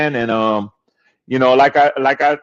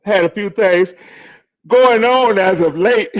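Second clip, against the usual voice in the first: yawning; 5 semitones higher than usual. Real, not acted. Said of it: "Excuse me for that yawning".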